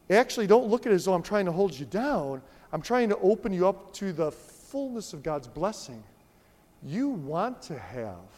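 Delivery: usual speaking voice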